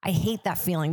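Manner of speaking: ordinary talk, nothing marked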